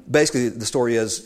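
normal talking voice

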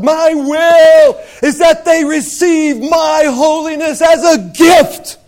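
slow, loud proclaiming voice of God. Acted. Preacher voicing God's will to the church, slow and loud. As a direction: declare it slowly, booming. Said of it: "Look at the heart of God".

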